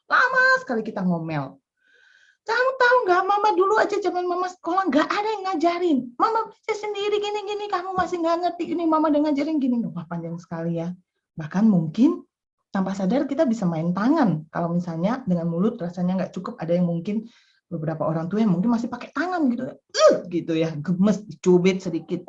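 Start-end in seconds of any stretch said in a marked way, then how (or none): 2.4-9.8 much higher, shrill-edged scolding rant; mother scolding child who still doesn't understand; mother's scolding. Retold or imitated. Mother scolding child over homework. 19.7-20.1 high, louder pinching "uh"; mother pinching the child out of annoyance; frustrated grunt. Retold or imitated.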